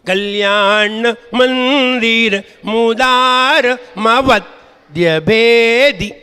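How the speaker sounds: slow, drawn-out recited Sanskrit verse; slow verse recitation. Acted. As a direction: recite the verse line syllable by syllable. Pupil recites verse slowly.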